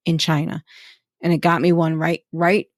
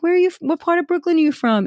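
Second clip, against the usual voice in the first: mock mom; 11 semitones higher, faster than usual. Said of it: "she's like".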